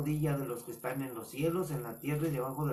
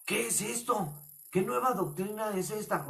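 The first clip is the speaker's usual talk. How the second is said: higher-pitched acted amazement; astonished; 6 semitones higher than usual. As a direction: ask like an astounded onlooker. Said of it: "admirados, atónitos". (asombro actuado, tono agudo)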